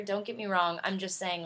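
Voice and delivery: usual speaking voice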